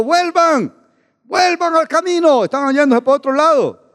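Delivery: imitated high, urgent call; calling from afar. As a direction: call them back from a distance. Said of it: "él de lejos le gritaba".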